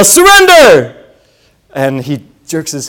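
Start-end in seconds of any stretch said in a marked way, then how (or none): 0.0-1.0 sharp high yell of one word; retold paintball yell, much higher. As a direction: burst out from behind the corner shouting it. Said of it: "I yell".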